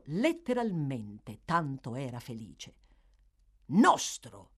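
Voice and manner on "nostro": loud, higher one-word retort; exclaiming. A narrator's reading. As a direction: throw the word back sharply. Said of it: "esclamò Sykes".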